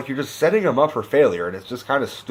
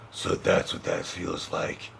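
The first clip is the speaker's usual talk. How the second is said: slow, much lower and softer rasp; Batman voice; 7 semitones lower, 9 dB quieter than usual. Retold or imitated. Batman alone after Catwoman vanishes, low rasp. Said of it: "in the Batman voice".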